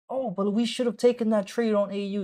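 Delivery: mock the trader who missed out; mock whiny trader. Streamer mocking viewers who regret a missed trade, whiny.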